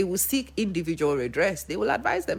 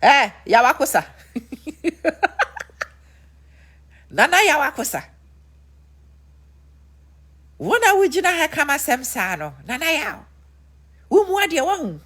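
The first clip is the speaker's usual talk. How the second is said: much higher, teasing brush-off; 9 semitones higher, 4 dB louder than usual. Retold or imitated. [name] brushing off [name], high mocking. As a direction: laugh him off. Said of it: "And this is what [name] said".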